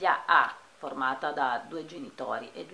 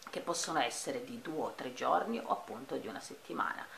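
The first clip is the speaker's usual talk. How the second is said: ordinary delivery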